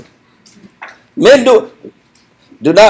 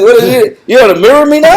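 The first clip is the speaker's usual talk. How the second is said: mock indignant; 6 semitones higher than usual. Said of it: "Like, I said".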